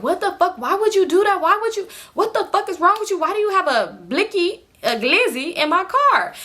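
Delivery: high, rapid-fire outraged questions; mock freaked-out rant; upset yelling. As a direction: scold her in high disbelief. China yelling at Amber.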